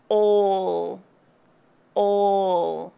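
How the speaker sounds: rounded aw; aw in all. Shown as a demonstration. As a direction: pull the chin back for "All". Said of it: "Notice how I close my chin".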